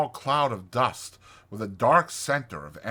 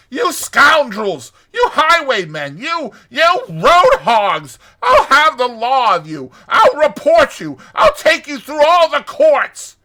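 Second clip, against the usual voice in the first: high, full-volume tirade; furious shouting; 15 dB louder than usual. A narrator's reading. Rat shaking both fists at the fleeing motorists, enraged high shouting. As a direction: hurl each threat at the top of your voice. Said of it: "he shouted, shaking both fists".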